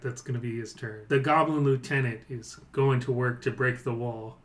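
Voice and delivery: normal talking voice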